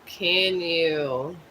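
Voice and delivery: normal talking voice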